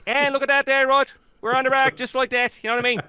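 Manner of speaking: for the host's wife, a full Newfie accent. Shown as a demonstration. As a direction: chat up Rod like a cheery Newfie. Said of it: "She wants to hear the Newfie accent again".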